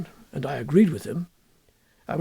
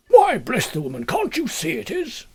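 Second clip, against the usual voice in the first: testy inspector; 7 semitones higher than usual. A narrator's reading. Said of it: "a hot temper in his chilly constitution".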